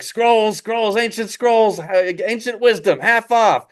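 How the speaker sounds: high, drawn-out vendor's cry; street hawker. Acted. Vendor selling bootleg scrolls, high hawking cry. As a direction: hawk wares with stretched calls. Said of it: "somebody hawking, like, bootleg scrolls".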